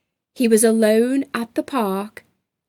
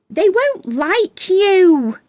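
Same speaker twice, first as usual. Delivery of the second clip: grumpy; 8 semitones higher, 6 dB louder, slower than usual. Acted. Gray the grumpy feeling speaks.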